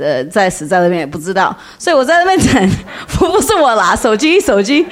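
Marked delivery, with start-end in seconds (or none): 2.8-4.9 laughing. Real, not acted. Laughing correction of own slip.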